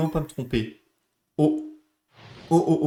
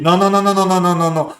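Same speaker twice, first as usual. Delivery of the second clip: loud repeated no, rising; loud pleading no; 2 semitones higher, 7 dB louder than usual. Real, not acted. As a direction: beg the game not to. Fears wrong choice in game.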